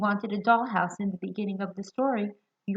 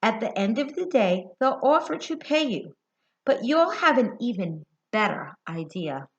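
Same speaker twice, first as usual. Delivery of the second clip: put-on lively storybook reading to kids; reading to children; 4 dB louder, slower than usual. A narrator's reading. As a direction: read it to a group of little ones, bright and clear. Reads picture book aloud.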